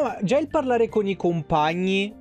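ordinary talk, nothing marked

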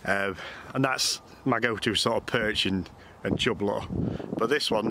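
ordinary talk, nothing marked

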